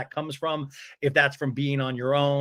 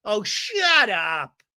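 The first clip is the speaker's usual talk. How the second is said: very slow, drawn-out mock brush-off; dismissive mimic; 7 semitones higher, 3 dB louder, much slower than usual. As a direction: stretch it out like waving someone off. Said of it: "gives her the hand".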